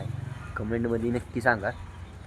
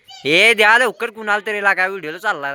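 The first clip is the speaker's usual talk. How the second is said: excited; 9 semitones higher, 6 dB louder than usual. Real, not acted. Urging viewers, voice jumps up.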